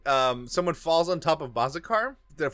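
usual speaking voice

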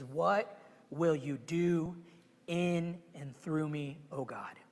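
slow, soft prayer to God; hushed prayer. Acted. Preacher models a humble prayer, slow and soft. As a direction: pray it softly, slowly.

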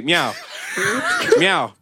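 small pretend cat mews; cat meow. Cat checking the bowl of milk, mock meowing. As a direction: play a cat accepting what's in the bowl. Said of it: "That's me".

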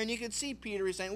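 ordinary talk

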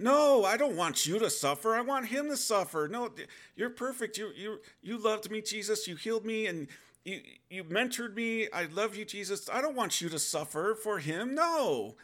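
high, loud, pleading protest; desperate pleading. Retold or imitated. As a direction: beg him desperately not to suffer. Begging Jesus not to suffer.

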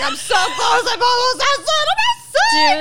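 frantic high shouting, climbing to a shriek; overexcited dog. Acted. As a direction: build to a bursting shriek of joy. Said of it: "you tell your dog".

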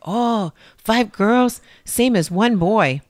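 mocking the old saying, drawn out; mock folk saying. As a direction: parrot the old saying slowly and loud. People's saying quoted mockingly.

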